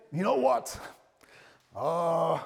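loud, high American preacher accent. Shown as a demonstration. Pastor starts imitating an American preacher, American accent. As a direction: put on a hammed-up American accent. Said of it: "sorry, this is my American, um, accent".

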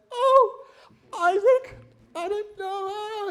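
mock-surprised fiancée squealing in a high falsetto; gushing bride. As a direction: play the overwhelmed bride in a shrill falsetto. Rebecca's mock-surprised reply.

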